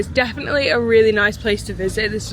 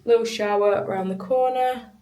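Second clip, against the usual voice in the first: usual speaking voice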